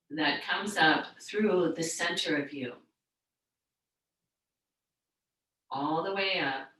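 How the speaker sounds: soothing yoga teacher settling the room; guided meditation. Real, not acted. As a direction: gently lead a class into stillness, unhurried. Leads a seated meditation.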